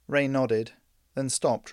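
ordinary delivery, nothing marked

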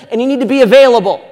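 loud, high and fast on "available"; rousing rally exhortation. Retold or imitated. Pastor quoting the men's rally line loud and fast. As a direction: punch "available" like a hyped-up rally cry. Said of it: "we'll say things like".

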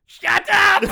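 loud, high villain yell; villain shout. Acted. Villain snapping in the bit.

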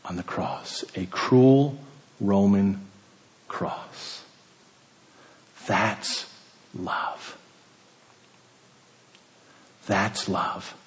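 dropping to a near whisper on "cross"; hushed. Real, not acted. Preacher hushing as he reaches the cross. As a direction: let it fall away to breath. Sermon climax, voice dropping to hush.

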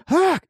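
high imitated drunken yelp; short squealed attack cry; mock battle cry. Drunk brother smacking with a phone, high mock yelp.

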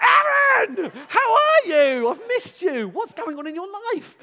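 very high, loud greeting, fading; excited shout. Retold or imitated. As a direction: bellow a greeting to a friend. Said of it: "like a lunatic".

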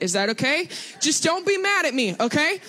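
normal talking voice

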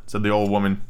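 ordinary delivery

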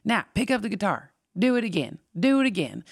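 high bossy Southern teacher; mock Southern drill instructor; Southern accent. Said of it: "he had this really thick Southern accent".

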